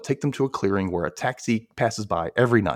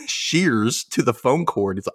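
usual speaking voice

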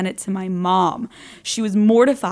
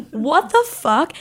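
slow, drawn-out what the fuck; slow stunned disbelief; disbelieving; 5 semitones higher, much slower than usual. Real, not acted. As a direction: stretch it out in disbelief. Reacting to listener story.

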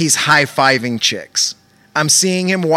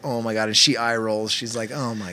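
quiet aside; 5 semitones lower, 8 dB quieter than usual. Retold or imitated. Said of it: "I'm just like".

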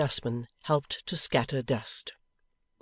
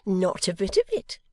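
Mrs Boffin crying out; 6 semitones higher, 3 dB louder than usual. Acted. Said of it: "cried Missus Boffin".

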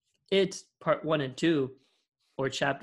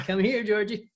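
higher-pitched luring call; mock creepy clown; 7 semitones higher, 3 dB louder than usual. Podcaster playing clown luring Georgie, higher put-on voice. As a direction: call the child over in a silly clown voice.

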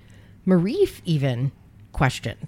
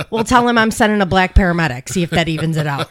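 loud retold yell, easing off; raised-voice comeback in a retelling; yelling back; 6 dB louder than usual. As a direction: call it back loudly. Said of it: "I yelled back".

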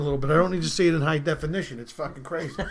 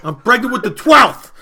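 loud, higher, hammed-up tirade; furious wife; 6 semitones higher, 10 dB louder than usual. Pregnant wife of eleven answers her husband, loud mock fury. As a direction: fire back, building to a yelled punchline.

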